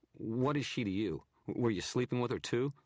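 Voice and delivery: sneering, loud challenge; contemptuous photographer. A narrator's reading. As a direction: throw the question in his face. Narrator voices photographer.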